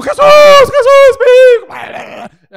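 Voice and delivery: high shouted pleading; desperate cry. Acted. Parent in crowd begs Jesus, high shouted plea. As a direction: scream for Jesus over the crowd.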